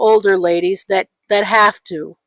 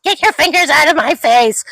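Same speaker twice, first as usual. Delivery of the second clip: whiny mock complaint; 7 semitones higher than usual. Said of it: "He's like".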